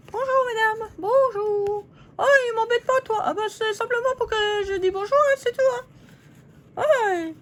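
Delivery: high squeaky paper-doll voice, bickering; squeaky puppet lady. Acted. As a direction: play a cheeky little figurine squabbling with her neighbour. Voices paper ladies squabbling.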